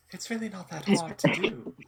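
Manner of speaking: high, loud, through the teeth; Moor's voice. Shown as a demonstration. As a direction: squeeze it out through your teeth. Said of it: "like, the Moor's voice".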